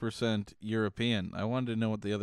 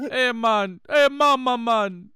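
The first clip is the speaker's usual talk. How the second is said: much higher, louder hammed-up Jamaican accent; mock Jamaican accent; 12 semitones higher, 9 dB louder than usual. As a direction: rant like a fake Jamaican. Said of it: "doing a Jamaican accent".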